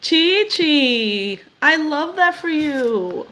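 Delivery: high, slow and tender; tender, moved. Real, not acted. Host to a viewer named Chi Chi, tender and moved. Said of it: "I literally could cry right now".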